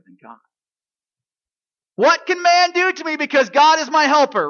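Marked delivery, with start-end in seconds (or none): 2.0-4.5 high, loud, ringing declaration; a bold shout of faith; triumphant proclamation. Acted. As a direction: cry it out in triumph.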